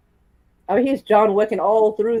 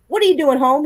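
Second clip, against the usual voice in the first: grumpy dad; 7 semitones higher than usual. Retold or imitated. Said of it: "Martin Lawrence is grumpy".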